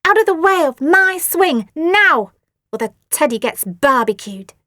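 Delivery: high, loud menacing threat; bully's threat. A narrator's reading. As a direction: threaten the teddy. Asti threatening Phoebe.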